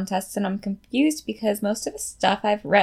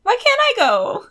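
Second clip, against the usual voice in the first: high indignant mock vent; mock outrage; 4 dB louder than usual. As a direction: whine like a jealous friend. Mock venting.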